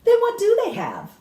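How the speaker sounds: put-on the boy can't believe it; boy presses his grandmother, amazed; astonished boy. A narrator's reading.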